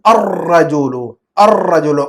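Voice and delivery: الرجل with the laam silent and a long trilled R; assimilated article. Shown as a demonstration. Teacher models the reading for pupil, الرجل with rolled doubled R. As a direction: run the A straight into a held rolled R in الرجل. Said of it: "آ کو لے جا کر سیدھا را میں touch".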